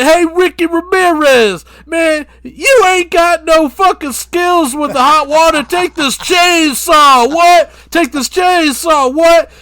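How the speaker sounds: slow, high, hollered taunt; very loud with stretched-out words. Acted. Acting [name] taunting [name].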